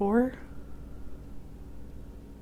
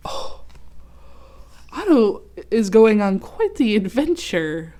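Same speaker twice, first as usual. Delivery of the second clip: ordinary delivery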